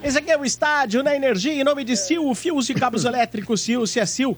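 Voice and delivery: smooth sponsor read over music. Acted. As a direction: sell it like a radio ad. Reads sponsor ad.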